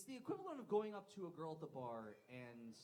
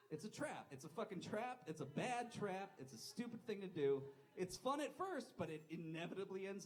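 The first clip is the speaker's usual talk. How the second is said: louder, higher, insisting it's a trap; emphatic warning; 6 semitones higher, 6 dB louder than usual. Real, not acted. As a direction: hammer home the warning to the crowd.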